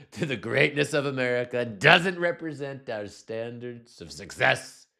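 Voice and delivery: Trump voice. Retold or imitated. Host mocking Trump's post to viewers, hammed-up Trump impression. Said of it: "in my Trump voice".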